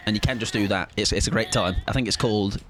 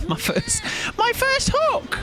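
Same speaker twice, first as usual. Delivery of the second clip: high excited squeal; slower than usual. Real, not acted. Streamer celebrating his first hook, high squeal. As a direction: squeal with triumph.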